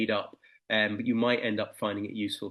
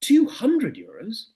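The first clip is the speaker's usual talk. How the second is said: higher, louder, slow and deliberate; higher, slow young seller; eager son; 10 semitones higher, 7 dB louder, much slower than usual. Acted. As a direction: state the price boldly like a proud boy. Son names price.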